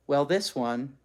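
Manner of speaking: ordinary delivery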